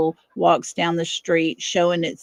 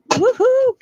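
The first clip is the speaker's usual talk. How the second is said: much higher, louder celebratory woohoo; cheering whoop; 5 dB louder than usual. Real, not acted. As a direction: whoop for the team. Cheering for team.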